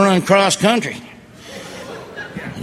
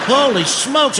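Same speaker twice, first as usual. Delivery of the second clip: pitched up, amazed holy smokes; surprised exclaim; 7 semitones higher, slower than usual. Real, not acted. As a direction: gasp it out in disbelief. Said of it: "exclaiming at the toenail".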